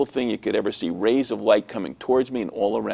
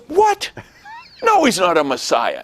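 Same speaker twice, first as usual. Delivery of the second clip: shrieked high "what", then loud denial; outraged rabbi; 8 semitones higher, 3 dB louder than usual. Retold or imitated. Rabbi answers troublemaker's question, loudly scandalized. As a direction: imitate a scandalized teacher, pitch leaping up.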